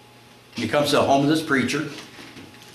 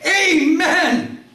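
high, loud, drawn-out cry; desperate sudden recall; 5 dB louder than usual. Acted. Rider finally remembers how to stop the horse, desperate cry. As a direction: belt it out as a last-second command. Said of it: "And finally he remembers".

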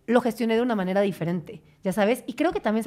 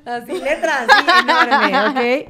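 laughing; 3 semitones higher, 7 dB louder, much slower than usual. Real, not acted. Leyendo sin lentes, riendo.

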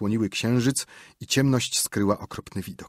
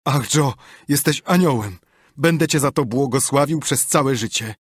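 higher, louder, gushing relief; grateful Potter; 5 semitones higher, 5 dB louder than usual. A narrator's reading. Potter thanks Joe for his promise, gushing and high. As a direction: thank your saviour through near tears.

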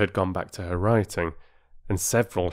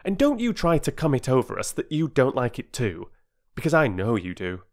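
lightly voiced insistent boy; forthright boy; 6 semitones higher, 4 dB louder than usual. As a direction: challenge your sister bluntly. Voices Peter challenging his sister.

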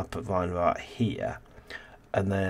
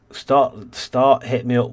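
loud call; 4 semitones higher, 10 dB louder, much slower than usual. Real, not acted. Loud calling out of mods prompt.

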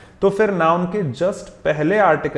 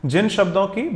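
ordinary delivery

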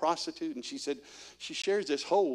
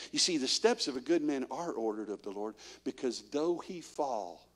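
ordinary delivery

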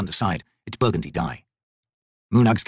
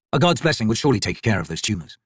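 usual speaking voice

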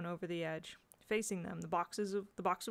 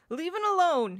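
loud, high angry snarl; angry growl; 10 semitones higher, 10 dB louder than usual. A narrator's reading. Boy knocking Jane's helping hand away, angry growl. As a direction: warn them off forcefully. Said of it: "he growled".

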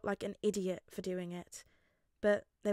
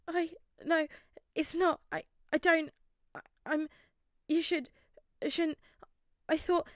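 high, panicked, breathless stammer; gasping, near tears; 9 semitones higher, 5 dB louder than usual. A narrator's reading. Boy stammering apologies to his classmate, gasping. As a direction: gasp the broken words out high and loud. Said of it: "Midoriya gasped out".

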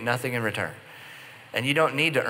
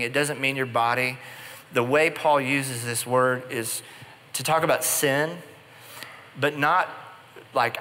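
ordinary delivery

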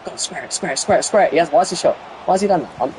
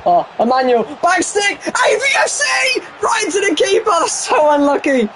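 excited commentary. Real, not acted. Streamer commentating his teammate's chance, high excited shout. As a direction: call it like a TV goal commentator.

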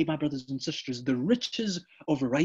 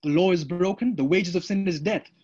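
usual speaking voice

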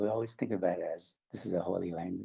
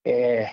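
"Eh" stretched long, loud and raised; stretched emphasis; 5 semitones higher, 10 dB louder than usual. Shown as a demonstration. Explaining Navajo emphasis to the host, loud stretched "Eh". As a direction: lean hard on "Eh" and hold it out. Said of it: "We stretch words".

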